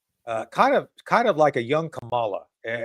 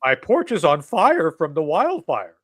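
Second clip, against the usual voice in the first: Thurston Howell impression; 3 semitones higher, 6 dB louder than usual. Host mocking NPR diction as Thurston Howell, hammed-up snob voice. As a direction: ham up a snooty millionaire crying for Lovey. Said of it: "same Thurston Howell School of Diction".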